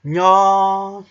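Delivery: nya said high and loud. Shown as a demonstration. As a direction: nya na wysokim, mocnym tonie. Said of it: "you can say a word like".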